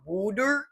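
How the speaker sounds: wooder with the vowel of wood, softly rising; произношение «wooder». Shown as a demonstration. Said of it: "like W O O D hyphen E R".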